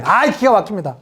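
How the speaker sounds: ordinary talk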